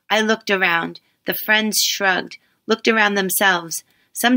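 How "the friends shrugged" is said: usual speaking voice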